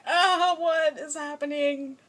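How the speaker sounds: the Doctor panicking at a sudden kiss; flustered panic. Retold or imitated. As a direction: cry out like a startled, baffled man. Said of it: "And he's like".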